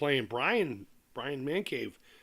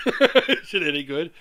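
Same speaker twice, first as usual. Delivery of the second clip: laughing repeat; 9 dB louder than usual. Real, not acted.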